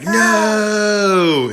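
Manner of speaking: high, loud, hammed-up "No!"; mock cry of no. Retelling the climax, a high loud mock "No!". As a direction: wail it like the family losing her. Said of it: "And they're like".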